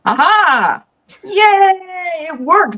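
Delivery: delighted cheer, high and loud; happy yay. Real, not acted. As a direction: celebrate the guest finally appearing. Stream finally connects, host cheers.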